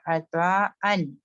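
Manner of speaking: slow, clearly separated recitation; careful Quranic recitation; clear demo. Shown as a demonstration. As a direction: pronounce each sound distinctly, slowly. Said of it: "इसको आपने clear पढ़ना है".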